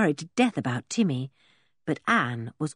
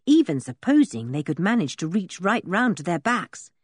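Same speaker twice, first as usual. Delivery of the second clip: ordinary delivery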